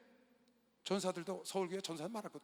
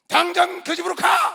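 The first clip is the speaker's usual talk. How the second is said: 높고 크게 천천히 외치는 명령; 한 자 한 자 크게 내지르는 호통; 11 semitones higher, 21 dB louder, slower than usual. Retold or imitated. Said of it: "막 야단을 쳤어요". (전도사에게 당장 가라고 크게 호통)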